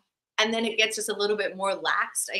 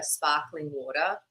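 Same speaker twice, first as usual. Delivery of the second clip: Australian vowels, "sparkling water" with both R's dropped; non-rhotic Australian "sparkling water"; Australian accent; 4 semitones lower, 3 dB quieter, slower than usual. Retold or imitated. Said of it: "born out of necessity, like, asking a waiter".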